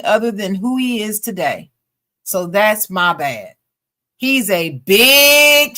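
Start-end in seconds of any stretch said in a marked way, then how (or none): 4.2-5.8 big stretched slow, higher and louder; big said slow and punched; stress on big. Retold or imitated. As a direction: lean hard on big, drawn out. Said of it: "she emphasized big".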